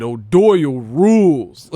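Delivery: slow, loud movie line; Billy Madison quote. Retold or imitated. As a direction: drag it out like the film. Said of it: "he did the Billy Madison".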